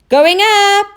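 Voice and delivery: high loud call; shouted. Acted. Oscar at the crane shouting to the deck, loud and high. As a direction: call it out to the crew. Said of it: "shouted Oscar".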